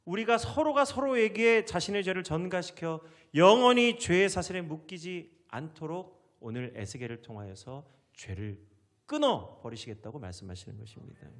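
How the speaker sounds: normal talking voice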